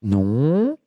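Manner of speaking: low, halting, lonely oddball; dopey confused voice. Acted. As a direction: play a muddled lonely man rambling. Keeps confused caller character.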